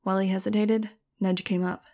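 usual speaking voice

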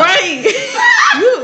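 high laughing outburst; laughing shout. Real, not acted. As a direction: burst out laughing. Bursting out laughing.

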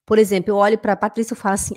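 usual speaking voice

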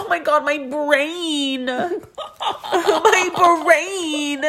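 high, slow hammed-up sobbing with laughter breaking through; fake-cry it while cracking up; mock crying. Katie crying to her friends about her brain, mock sob. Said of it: "And so Katie's crying".

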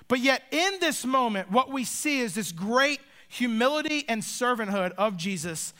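ordinary delivery

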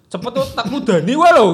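loud sharp threat to a little thief; mock angry threat. Shopkeeper threatening a thieving kid, mock angry. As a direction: bark it at the kid like you mean it.

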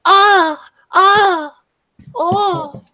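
high breathy anime moans; hentai moaning. Pretend. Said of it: "Japanese women going".

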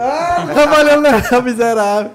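high, slow laughing jab; laughing tease. Real, not acted. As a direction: tease him through a laugh. Teasing the courier playfully.

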